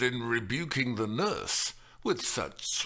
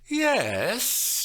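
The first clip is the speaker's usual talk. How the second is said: tentative; 14 semitones higher, 7 dB louder than usual. Acted. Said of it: "she said tentatively".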